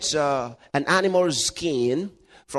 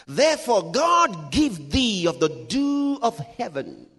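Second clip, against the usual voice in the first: slow, raised scripture proclamation; proclaimed blessing; 5 semitones higher, 3 dB louder, slower than usual. Acted. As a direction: declaim it as a grand blessing, stretching the words. Declaims Isaac's blessing from scripture.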